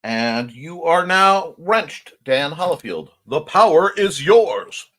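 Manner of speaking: slow, loud, grand announcement; hammed-up herald voice; mock proclamation. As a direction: crown him with ceremony. Handing over power grandly.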